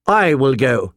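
volunteer aloud with modest force; mildly loud. A narrator's reading. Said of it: "she said loudly".

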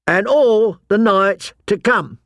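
slow, high-pitched closing vow; oath recitation. A narrator's reading. Pip completing the oath to John, reciting. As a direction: finish the vow with deliberate weight.

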